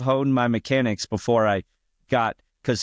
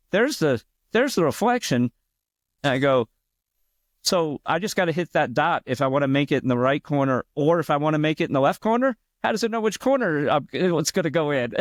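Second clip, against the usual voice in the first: mock questioning; 6 semitones higher than usual. Retold own mocking questions.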